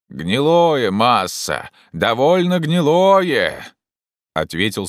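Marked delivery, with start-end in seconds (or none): 0.1-3.7 slow, raised-pitch reply; calling down. Acted. Jupiter reports rotten wood, calling down. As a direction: stretch the words out from up high. Climber answers from above.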